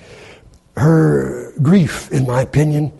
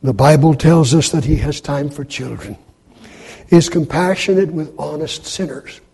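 ordinary talk

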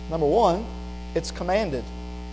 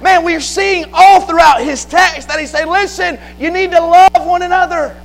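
high, forceful pulpit exhortation; fervent preaching; 7 semitones higher, 5 dB louder than usual. Real, not acted. Preacher rebuking feuding churches, fervent preaching. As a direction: pile up the charges in a raised, urgent cadence.

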